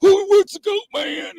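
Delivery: impersonating Greg, high and baffled shout; mock Greg yelling. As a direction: holler it like a big lanky country boy. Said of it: "You know how Greg is, right?".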